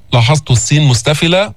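ordinary talk, nothing marked

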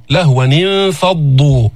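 لهوا انفضوا read as lahwan-infaddu, the nun joined with i; linking with kasra. Shown as a demonstration. Teacher shows how to link لهوا انفضوا. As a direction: join the tanwin to انفضوا with a kasra. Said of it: "هيك بن-، نقرأها".

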